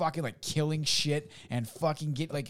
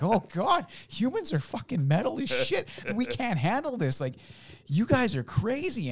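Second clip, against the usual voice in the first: awed alien; 12 semitones higher, 3 dB louder than usual. Retold or imitated. Aliens marvel at humans.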